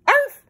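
short, high, loud yip; happy dog bark. A narrator's reading. Lassie shakes hands with Susan, barking happily. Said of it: "Lassie barked happily".

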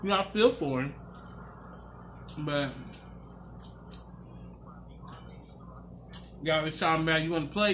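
talking with mouth full; speaking between bites; chewing food. Real, not acted.

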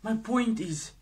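ordinary delivery

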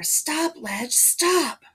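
mother's urgent shout; urgent plea. A narrator's reading. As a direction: call out loudly, pleading for it to end. Mother begging child to stop.

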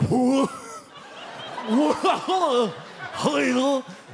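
high, drawn-out dopey hoot; goofy laugh. Acted. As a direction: imitate a cartoonish goof's noises. Imitates goofy man looking at passengers.

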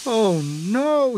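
high, alarmed little engine; frightened cry. A narrator's reading. Percy fearing Sir Topham Hatt's anger, crying out. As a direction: cry out in dismay. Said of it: "he cried".

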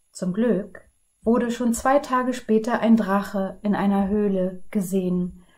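put-on lively read-aloud for children; storybook narration. A narrator's reading. Librarian reading a fairy tale to children, lively and clear. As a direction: tell the tale to the kids with energy.

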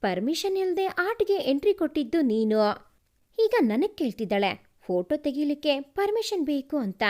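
ordinary delivery

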